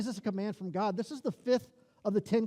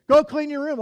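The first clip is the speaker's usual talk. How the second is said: parent's order; 5 semitones higher, 8 dB louder than usual. Retold or imitated. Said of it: "I'll go with a happy".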